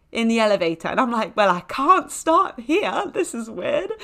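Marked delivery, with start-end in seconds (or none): 1.4-3.9 slow, high-pitched awkward voice; high, awkward squirm. Acted. Stuck in the elevator with a stranger, high and awkward. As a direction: stretch it out high, mock-awkward.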